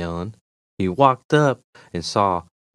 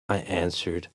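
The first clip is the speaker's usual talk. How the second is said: usual speaking voice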